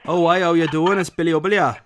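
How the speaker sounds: put-on Geordie accent. Prank caller to the shop clerk, putting on a Geordie accent. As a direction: Geordie builder on the phone, chirpy. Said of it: "Now you're Geordie".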